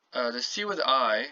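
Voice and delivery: ordinary talk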